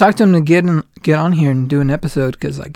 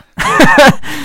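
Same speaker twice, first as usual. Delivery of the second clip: high laugh; 12 semitones higher, 4 dB louder than usual. Real, not acted. Laughs after boast.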